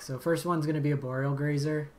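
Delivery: ordinary talk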